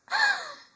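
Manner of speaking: sharp, high gasp; high dramatic gasp. Pretend. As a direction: gasp in mock shock.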